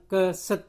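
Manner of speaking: "keset" misread as kuh-sut; "keset" pronounced kə-sət; wrong schwa. Shown as a demonstration. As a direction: say "keset" with schwa in both syllables. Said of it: "bukan keset".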